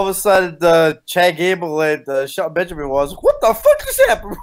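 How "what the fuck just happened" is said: very high, loud shocked squeal. Retold or imitated. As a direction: squeal like you can't believe it. Retold shock at the result.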